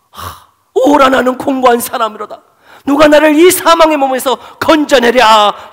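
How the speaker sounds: high, loud cry of despair; anguished wail. Acted. Preacher voicing Paul, wailing high and loud in despair. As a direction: scream it like a man drowning in his own sin. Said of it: "절규하는 이 절규".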